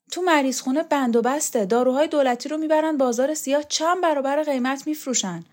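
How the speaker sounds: put-on puzzled young man pressing his point; Borzu's voice. Young student Borzu speaking.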